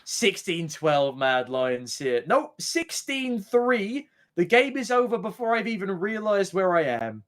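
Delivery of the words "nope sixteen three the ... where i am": wide-eyed shocked disbelief; excited disbelief. Real, not acted. Caster reacting to fast win.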